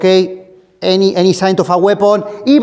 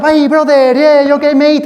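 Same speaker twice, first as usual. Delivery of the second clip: high, louder brotherly hello; friendly check-in; 8 semitones higher, 4 dB louder than usual. Retold or imitated. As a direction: disarm him with warmth. Retelling friendly approach.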